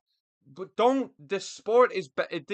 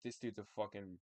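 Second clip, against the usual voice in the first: soft, dismissive under breath; muttered insult; 5 semitones lower, 12 dB quieter than usual. Retold or imitated. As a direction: mutter it low to yourself. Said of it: "I was like".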